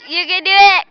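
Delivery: loud encouraging shout; cheering. Real, not acted. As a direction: cheer them on loudly. Cheering friend on.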